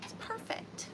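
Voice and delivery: barely voiced whisper; soft, whispered repeat; hushed. Real, not acted. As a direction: whisper it to yourself in admiration. Awed repeat about the postcard.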